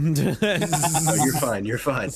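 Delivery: loud, reassuring; loud reassurance. Acted. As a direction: boom out the reassurance. Kale reassures loudly.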